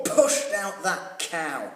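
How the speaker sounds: ordinary delivery, nothing marked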